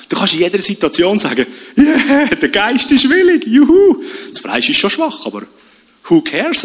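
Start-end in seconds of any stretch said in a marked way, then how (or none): 1.8-6.6 „willig“ jubelnd hoch und laut betont, „juhu“ ausgelassen; jubelnd, Betonung auf willig. Shown as a demonstration. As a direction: den Geist feiern, das Fleisch wegwischen. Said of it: "in der falschen, ähm, Betonung". (begeistert jubelnd, Ton auf „willig“)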